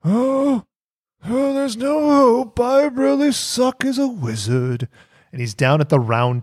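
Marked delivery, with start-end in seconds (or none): 0.1-4.9 high, slow, moping lament; whiny wizard. Retold or imitated. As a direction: whine it like a defeated wizard. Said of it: "laborious speech about".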